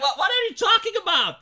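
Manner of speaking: loud, disbelieving what are you talking about; outraged shout. Real, not acted. Speaker yelling at the psychologists' tree test, outraged and loud. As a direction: yell it in outrage at the nonsense.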